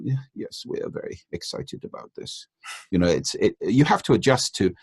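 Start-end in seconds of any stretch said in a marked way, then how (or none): 0.0-2.5 flat, hushed Scandinavian delivery of "excited"; restrained Scandinavian. Retold or imitated. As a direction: whisper it like an understated Scandinavian. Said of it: "Scandinavian prosody tends to be far more restrained".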